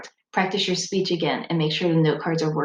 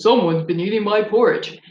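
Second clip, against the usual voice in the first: Papa Bear voice; 3 semitones higher, 4 dB louder than usual. Shown as a demonstration. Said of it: "our Papa Bear voice".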